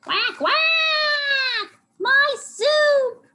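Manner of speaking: very high, loud quacking wail; outraged cartoon duck shout; upset duck. A narrator's reading. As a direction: quack and cry out in dismay. Upset duck character in story.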